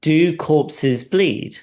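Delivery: mock-patient doctor testing a delusion, drawn out; probing question. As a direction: stretch each word out like a clever trap. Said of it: "So he goes".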